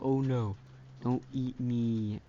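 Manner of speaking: slow pleading little cupcake voice, lightly acted; scared cupcake. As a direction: plead slowly not to be eaten. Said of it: "and now it's scared".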